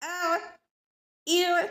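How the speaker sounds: अ ई उ throaty, not drawn out; ain vowels demo. Shown as a demonstration. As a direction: keep the throat sound short and tight. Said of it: "time नहीं लगेगा इसको". (अ ई उ हलक से, लंबा न करके)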